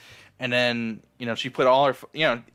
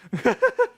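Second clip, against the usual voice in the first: high laugh. Real, not acted. Laughs at the scene.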